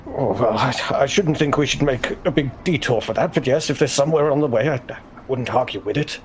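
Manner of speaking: ordinary talk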